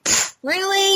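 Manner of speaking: high, soft incredulous scoff. Retold or imitated. Friends hearing about weak painkillers, scoffing disbelief. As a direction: puff dismissively, then squeak the question.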